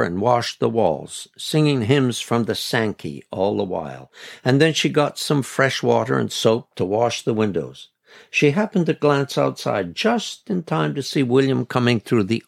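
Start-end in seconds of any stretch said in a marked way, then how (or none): none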